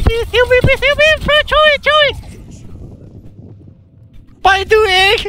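frantic whining call for help; desperate pleading. Real, not acted. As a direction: plead with the healer by name. Begging Joey for heals.

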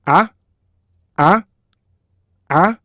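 springy, rising "아"; 탄력적인 소리. Shown as a demonstration. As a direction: bounce "아" up off a hidden K stop. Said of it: "탄력적인 소리가 나요". ("아"를 탄력 있게 올려서)

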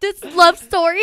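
loud, high, slow lament; hammed-up tragic wail; mock heartbreak. Woman mourns the birds' love story, mock wailing. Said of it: "it was so sad".

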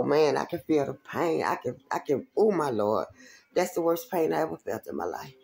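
ordinary delivery

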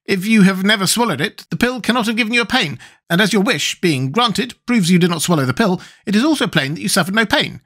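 high character voice working out a sum; Wogglebug, pedantic. Acted.

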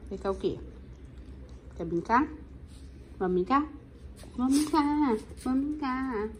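loud, eager play voice; excited pet talk. Real, not acted. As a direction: rile the dog up to play.

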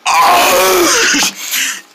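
high groan as if choking something up. Acted. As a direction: groan like something's stuck in your throat. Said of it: "trying to choke up something from your throat".

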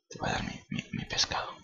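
unvoiced coaxing whisper; hushed coaxing. Real, not acted. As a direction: whisper to lure the cat. Coaxing cat quietly.

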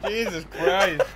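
loud, high, laughing through every word; helpless laughter. Real, not acted. Co-host pleads with laughing partner, cracking up himself. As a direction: barely get the words out between laughs. Both caught in laughing fit.

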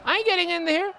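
player's wrestler refusing to enter the ring; high mock refusal. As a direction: whine your way out like a scared heel. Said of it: "I'm like".

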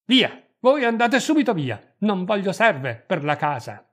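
high, loud order to get out; furious, commanding outburst; angry dismissal. A narrator's reading. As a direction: throw the servant out loudly. Angrily sends the servant away.

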